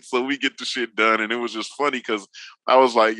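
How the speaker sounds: ordinary talk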